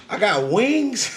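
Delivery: high, disbelieving question; amazed question. Retold or imitated. Man told by spirits he has wings, amazed high question. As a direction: ask it as if stunned by the news. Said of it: "I'm like".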